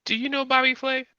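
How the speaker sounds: high-pitched probing question; in-character question. Acted. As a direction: ask it pitched right up high. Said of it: "I'm gonna be like".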